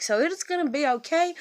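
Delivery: self-reassuring. Real, not acted. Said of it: "trying to talk, you know, over myself".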